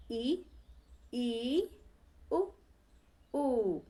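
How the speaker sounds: ई ई उ ऊ said slowly with short and long pairs; teacher drilling close vowels. Shown as a demonstration. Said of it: "तो छोटी ई, बड़ी ई".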